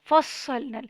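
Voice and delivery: فصلنا with the ص held and doubled; doubled sad. Shown as a demonstration. Teacher models فصلنا with ص doubled firmly. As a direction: lean into the shadda on ص in فصلنا. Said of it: "کیونکہ صاد پہ شد ہے".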